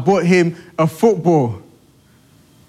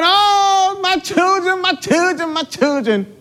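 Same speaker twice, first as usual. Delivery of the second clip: gushing proud father; 13 semitones higher than usual. Retold or imitated. Father gushing over third baby.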